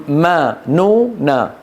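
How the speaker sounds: ما، نو، نا each with nasal hum held; ghunna syllables. Shown as a demonstration. Teacher slowly sounding out the nasal syllables ما، نو، نا. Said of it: "لأنه م".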